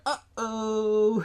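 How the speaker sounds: high squeaky creature "uh-oh"; mock Gizmo uh-oh. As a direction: squeak it like a small furry creature. Gizmo reacting in retold movie.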